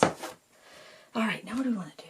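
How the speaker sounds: breathy hushed "All right"; whisper. Real, not acted. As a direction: say it under your breath. Whispered aside while painting.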